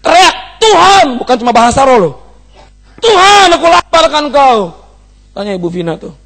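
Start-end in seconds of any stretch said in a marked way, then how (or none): 0.0-1.2 high, loud cry of God's name; shouted cry. Retold or imitated. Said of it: "Ketika mereka berseru". 3.0-4.6 loud, high, crying plea; tearful cry. Retold or imitated. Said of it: "Ketika mereka berseru".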